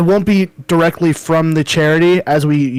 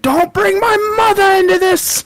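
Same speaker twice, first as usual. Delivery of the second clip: much higher, slow, stretched indignant yell; mock-offended shout; 4 dB louder than usual. Stranger from the video, shouting back indignantly. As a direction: act deeply offended across the street. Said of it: "He's like".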